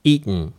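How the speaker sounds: eaten with the T swallowed into a glottal stop. Shown as a demonstration. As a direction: say "eaten" as "ee-n", no T release. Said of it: "Tを飲み込むので". (Tを飲み込んだ「eaten」)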